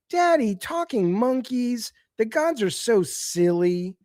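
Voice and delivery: put-on high, slow sing-song child voice; mocking little girl. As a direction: parody a cutesy little girl. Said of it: "the Calliope girl's like".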